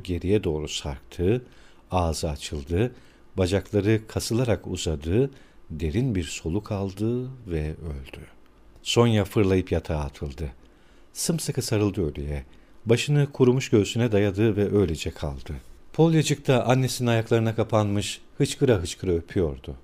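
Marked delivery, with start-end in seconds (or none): none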